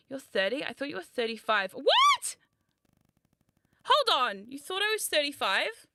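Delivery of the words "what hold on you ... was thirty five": high squeak of disbelief; stunned shriek at the guess; shocked. Real, not acted. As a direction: yelp it out in outrage. Shocked at being called thirty-five.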